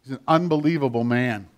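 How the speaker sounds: ordinary talk, nothing marked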